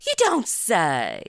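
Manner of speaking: slow put-on southern drawl; southern accent. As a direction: drawl it out like a local. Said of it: "I always start talking like 'em".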